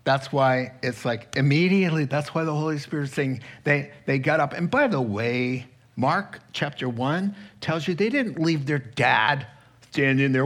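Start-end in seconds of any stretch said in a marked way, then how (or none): none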